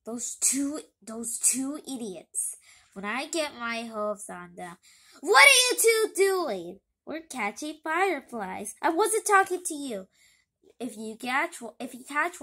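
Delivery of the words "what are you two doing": loud, higher demanding shout; angry yell. Acted. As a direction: bark it at the two kids. Villain yells at the kids.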